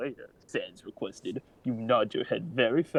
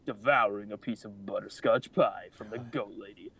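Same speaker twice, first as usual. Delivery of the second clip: plain reading voice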